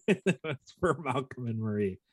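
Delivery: high-pitched, real laughter breaking into the words; laughing. Real, not acted. Owning up to a joke nomination for co-host, laughing. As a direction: laugh through the correction.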